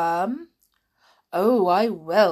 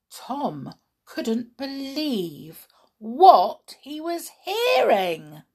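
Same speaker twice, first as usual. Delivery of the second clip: ordinary delivery, nothing marked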